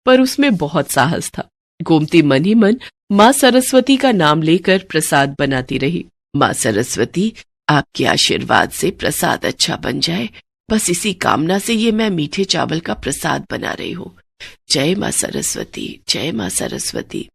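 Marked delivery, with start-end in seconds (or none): 6.4-17.3 quiet prayer. Acted. Old woman prays to Saraswati over the cooking, softly. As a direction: murmur a humble prayer.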